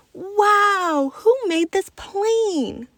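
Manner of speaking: put-on a kid awed by the plane; amazed boy. A narrator's reading. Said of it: "Carlos asked".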